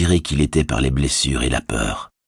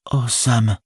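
higher, pained exclamation; remorseful cry; 7 semitones higher than usual. A narrator's reading. Hobbit coming to his senses before his servant, remorseful. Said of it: "s'écria Frodo".

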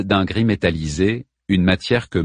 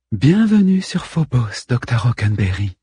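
soft, almost feminine voice; 3 semitones higher than usual. A narrator's reading. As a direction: speak softly, nearly feminine. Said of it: "La douceur de sa voix était quasiment féminine".

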